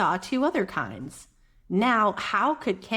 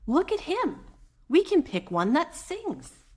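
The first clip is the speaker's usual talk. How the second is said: put-on excited child voice; 8 semitones higher than usual. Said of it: "I said".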